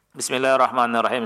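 ordinary talk

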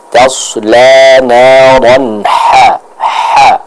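ha drawn from the throat, very slow; breathy throat ha. Shown as a demonstration. Teacher demonstrating the throaty ha, very slow. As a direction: push a breathy ha from the mid throat. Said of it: "Kita jelaskan pengucapannya".

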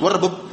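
"werbeb" run together as one made-up word; abbreviation misread as a word. Shown as a demonstration. Teacher warning students against reading initials aloud, misread "werbeb". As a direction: read the initials as "werbeb", two short syllables. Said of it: "jangan dibaca".

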